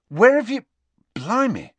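high, loud, astonished burst; Ron's voice jumping high in surprise at a bloody face; startled Ron. A narrator's reading. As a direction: gape at him in shock as Ron. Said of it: "said Ron, goggling".

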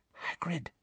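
very soft, voiceless whisper; a tiny hushed call; barely audible whisper. A narrator's reading. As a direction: whisper it under the breath. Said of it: "she said in a whisper barely audible".

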